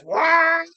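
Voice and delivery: loud, high race car wail; imitated engine scream. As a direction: roar like a dragster passing. Imitates car hauling past.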